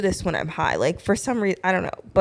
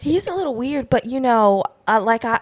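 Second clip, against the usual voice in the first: Southern belle; 2 semitones higher than usual. Pretend. Southern belle doubting a politician, Southern accent. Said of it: "this little Republican Southern belle".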